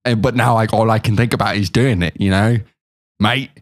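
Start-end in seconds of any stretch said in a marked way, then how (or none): 3.2-3.5 put-on British accent slipping into Australian; attempted British turning Australian. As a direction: try British, land on Australian. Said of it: "Now you're Australian".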